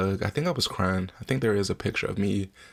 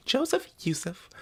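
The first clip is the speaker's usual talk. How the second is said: high, far-off name call, like over a PA; announcer calling name. Retold or imitated. Son recalls announcer calling his name, high and far.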